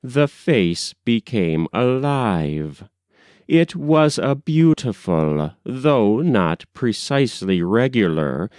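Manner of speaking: ordinary delivery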